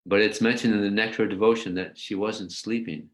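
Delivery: ordinary talk, nothing marked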